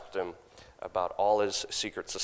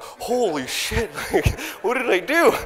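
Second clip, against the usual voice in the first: high, loud laughing disbelief; stunned laugh at being insulted; retold shock; 12 semitones higher, 7 dB louder than usual. Reacting to a grandma calling him scum, laughing disbelief.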